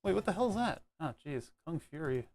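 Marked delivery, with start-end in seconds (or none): none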